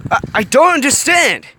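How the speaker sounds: high, loud confused yell; frustrated shout. A narrator's reading. Hiro shouting back at the alien, confused anger. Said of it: "Hiro shouted back".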